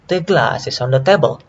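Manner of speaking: GLASS and TABLE strong, is on the quick and light; stress on content words. Shown as a demonstration. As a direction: glide over is on the, hit glass and table. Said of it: "only on content words".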